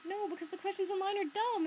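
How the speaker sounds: high, loud mock objection; imitating librarian. Librarian dismissing online questions, high loud protest. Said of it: "And she was like".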